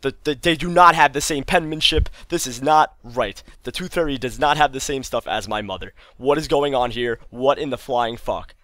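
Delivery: loud, incredulous retold outrage; indignant ranting, easing off toward the end; raging. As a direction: act out a furious discovery, starting high and loud. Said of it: "I guess, raging".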